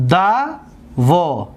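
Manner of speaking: دوا very slowly, each syllable standing alone; teacher sounding out دوا piece by piece. Shown as a demonstration. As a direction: break دوا into "da", pause, "vo". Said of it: "دقت بتید".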